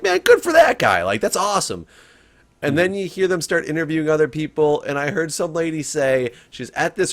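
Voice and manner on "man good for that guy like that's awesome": high, loud delighted exclaim; impressed cheer. Retold or imitated. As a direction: cheer him on, much higher. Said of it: "you're like".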